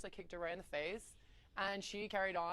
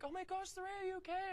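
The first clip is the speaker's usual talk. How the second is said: retold screaming; 11 semitones higher than usual. Family running out to the injured girl, mock screaming. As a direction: imitate alarmed onlookers in a much higher voice. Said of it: "comes running out screaming".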